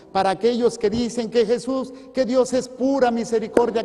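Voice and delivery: loud, high, impassioned preacher delivery; insistent, ringing sermon voice; full-force preaching. Real, not acted. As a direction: raise the voice high and preach it hard.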